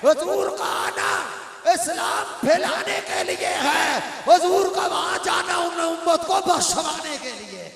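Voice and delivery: loud, ringing sermon delivery to the hall; full-force preaching. Acted. Preacher rousing the gathered congregation, full-force preaching. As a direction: preach it out to the crowd with fervour.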